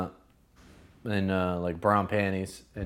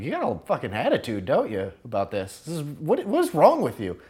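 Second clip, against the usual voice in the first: indignant scolding, rising higher and louder at the end; high-horse lecturing; 5 semitones higher, 4 dB louder than usual. Retold or imitated. Lecturing a girl about her attitude, indignant and rising. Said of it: "got on this high horse thing".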